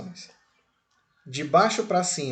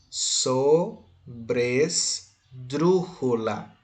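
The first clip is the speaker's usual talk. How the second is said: "Sobresdrújula" drawn out very slowly, syllable by syllable; much slower than usual. Shown as a demonstration. Teacher introducing the term sobresdrújula to students, syllable by syllable. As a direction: break "Sobresdrújula" into separate syllables with clear pauses.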